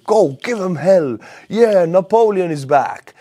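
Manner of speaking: loud, high-pitched rallying cry; mock rallying cheer. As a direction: cheer the troops into battle. Acts out French urging an offensive.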